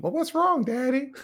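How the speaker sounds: put-on high-pitched child voice; cute little son. Small son asking Daddy, cute and high. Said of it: "and then he's so cute".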